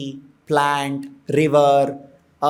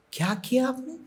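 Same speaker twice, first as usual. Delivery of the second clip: softer, mocking "Kya kiya aapne?" with a rising end on kiya; mocking child; 4 semitones higher, 10 dB quieter than usual. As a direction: sneer it quietly back at the parents. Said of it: "they talk like this to their parents".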